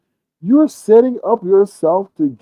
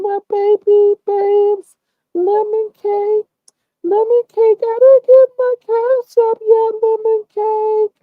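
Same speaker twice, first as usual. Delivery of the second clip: much higher, slow cooing woman's voice; drawn-out sweet talk; slower than usual. Retold or imitated. Imitating the woman cooing lemon cake to Lemon, slow and high. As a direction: stretch each word out sweetly. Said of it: "I was like".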